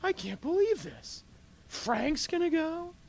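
stunned that the cheater gets in; a believer in line can't believe Frank is let in, slow and high; indignant disbelief. Acted. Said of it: "You're like".

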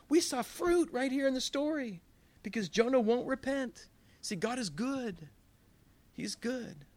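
high, fervent preacher voice; excited preaching. Real, not acted. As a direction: lift it up with zeal. Preacher rising to excited point.

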